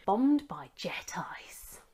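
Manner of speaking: dramatic hushed headline read; whispering. Acted. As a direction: whisper the news like a secret.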